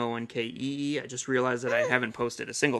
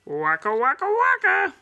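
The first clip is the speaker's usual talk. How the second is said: much higher, louder, slow bear bit; Fozzie catchphrase; 8 dB louder, slower than usual. Shown as a demonstration. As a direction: do the comic bear's joke sound. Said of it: "me doing Fozzie".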